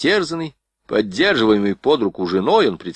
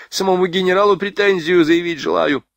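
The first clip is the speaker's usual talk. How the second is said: whining; 9 semitones higher, 3 dB louder than usual. A narrator's reading. Sergeant continues whining.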